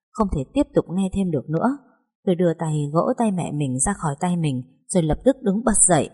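usual speaking voice

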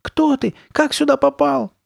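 very high, loud panicked questions; high-pitched squeal of fear; terrified wailing. A narrator's reading. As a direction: shriek it like a frightened little boy. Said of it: "завопил он, выпучив глаза от страха".

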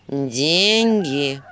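low, gruff owl reminder; a hint of a wise owl. A narrator's reading. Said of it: "напомнил Филин".

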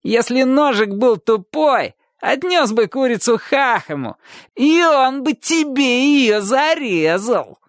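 high, slow, snide taunting; stretched-out, high-pitched jeer; pointed spite. A narrator's reading. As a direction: drag it out with malicious glee. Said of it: "сказал с далеко идущим ехидством".

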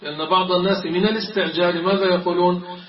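usual speaking voice

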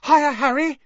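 high, loud breathless call; breathless excitement. Acted. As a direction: call out eagerly down the table. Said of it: "a highly excited, breathless voice called".

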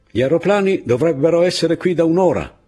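high, loud officer voice; officer's complaint. A narrator's reading. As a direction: raise pitch and volume in protest. Officer complains about late planes.

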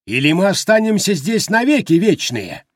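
high, loud piercing wail; piercing yell. A narrator's reading. Harper Baldwin screaming to be released, piercing yell. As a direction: shriek the question. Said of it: "пронзительно завопил Харпер Болдуин".

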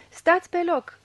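loud, high barked order; loud stern command; old man's command. A narrator's reading. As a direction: freeze them with a shout. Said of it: "poruncea bătrânul".

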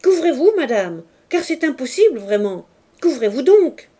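loud, high scandalised orders; indignant shouting. A narrator's reading. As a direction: command her to cover up, outraged. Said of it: "cria-t-elle".

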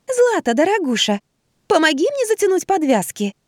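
put-on a man squeaking like a girl; squeaky falsetto. A narrator's reading. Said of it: "неестественным писклявым голоском".

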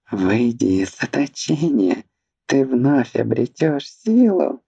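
high, wheedling fanatic's plea; pleading servant. Acted. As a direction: beg your master to rise. Markvin calls his master.

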